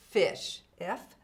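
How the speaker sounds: ordinary delivery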